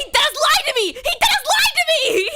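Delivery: high, shrieked complaint; hammed-up indignant shouting; outraged yell. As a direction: wail the accusation at the top of your range. Mock outrage at being lied to.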